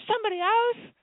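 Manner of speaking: high-pitched imitation of an astonished question; amazed disbelief. Retelling listener's amazed question.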